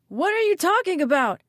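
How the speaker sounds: high, loud, bewildered yell; baffled shout. A narrator's reading. Eric shouts through the glass at them, baffled. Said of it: "Eric shouted through the glass at them".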